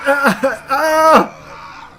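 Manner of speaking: high strained yelps; screamed cry of effort; high yell under attack; high pained cries. Real, not acted. Hurt in game fight.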